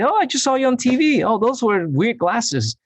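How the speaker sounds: very high, loud mock-excited squeal; sister-in-law impression. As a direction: imitate a chirpy relative phoning with news. Imitates sister-in-law phoning excitedly.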